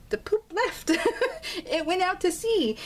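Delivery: high-pitched giggling through the words; laughing. Real, not acted. Telling husband's dog poop floating away, laughing.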